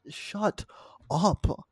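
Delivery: fired-up rant. Real, not acted. To the co-host, a fired-up high rant about fans. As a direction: boil over at the fans. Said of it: "you're fired up about this".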